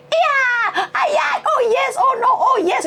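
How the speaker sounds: high-pitched mock cries of passion; imitated moaning. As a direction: squeal it out, imitating overheard lovers. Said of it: "tapi krungu".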